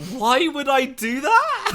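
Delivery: high, loud, drawn-out disbelief; incredulous. Retold or imitated. Host replaying his reply to the bar stranger, incredulous. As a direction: stretch it out in exaggerated bafflement.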